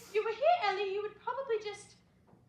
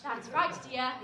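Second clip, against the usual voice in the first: ordinary delivery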